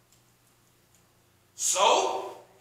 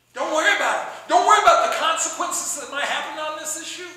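loud reassurance; 7 semitones higher, 9 dB louder than usual. Retold or imitated. King reassures Esther emphatically.

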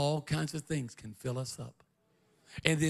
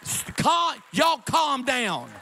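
firm reassurance; 10 semitones higher, 7 dB louder, slower than usual. Retold or imitated. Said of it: "I told him, I said".

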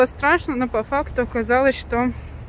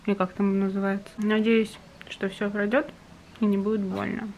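ordinary delivery

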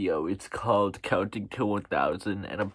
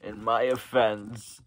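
ordinary talk, nothing marked

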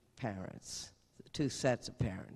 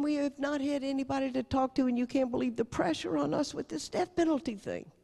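higher, earnest Cajun father; father unloading to the nun, higher; imitating Lloyd; 10 semitones higher than usual. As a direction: pour out the pressure, pitched up. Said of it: "And he said".

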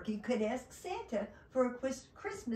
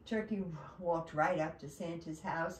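ordinary delivery, nothing marked

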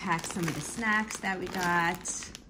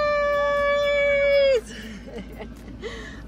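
high bright laughing squeal; delighted laugh; 7 dB louder than usual. Real, not acted. Mom laughing with her toddler over the cookies, delighted giggle. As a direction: laugh out with joy.

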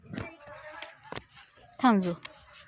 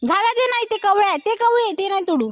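coaxing instruction; 8 semitones higher, 4 dB louder than usual. Real, not acted. Mother guiding toddler picking.

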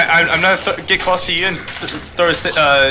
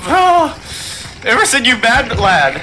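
excited shout; 7 semitones higher, slower than usual. Real, not acted. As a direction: hype up your teammate. Teammate makes a wild play.

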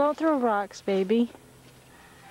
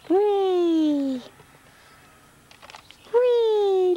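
playful whee; 8 semitones higher than usual. Real, not acted. Playing with baby's feet.

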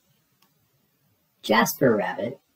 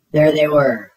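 loud startled reveal; dramatic jolt; 3 semitones higher, 7 dB louder than usual. A narrator's reading. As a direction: slam the twist out loud. Scary reveal.

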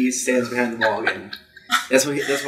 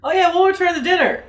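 high silly voice; 4 dB louder, faster than usual. Acted.

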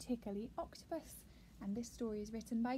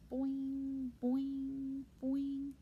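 drawn-out, even boings; bouncing sound effect; much slower than usual. Acted. Reader imitating the bouncing pearl, springy boings. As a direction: sing out each springy boing separately.

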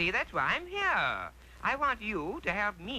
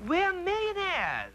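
overjoyed; 12 semitones higher than usual. Acted. Aladdin finding the treasure cavern, overjoyed. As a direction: burst out with joy.

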